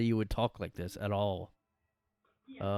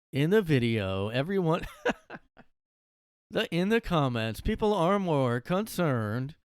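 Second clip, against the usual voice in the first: lightly mocking narrator; 6 semitones higher, 5 dB louder than usual. Creator still mimicking the narrator, louder and higher. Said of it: "mocking narrator".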